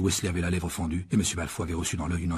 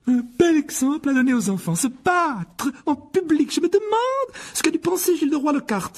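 very high, loud scolding wife; furious; 6 dB louder than usual. A narrator's reading. Mrs Weasley berates her husband for brawling, enraged. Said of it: "Madame Weasley folle de rage".

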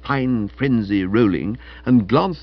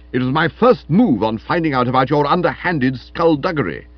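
loud, raised, aggrieved voice; indignant accusation; 4 semitones higher, 7 dB louder than usual. Acted. Stilton accusing Florence of deceiving him, loudly indignant. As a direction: accuse hotly.

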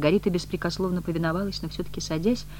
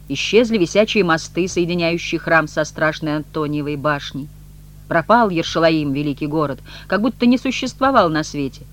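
taunting recitation; 5 dB louder than usual. A narrator's reading. Redhead quoting the manuscript at departing woman, loudly declaiming. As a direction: proclaim it grandly after someone leaving.

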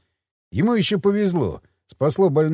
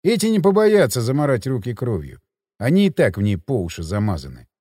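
ordinary talk

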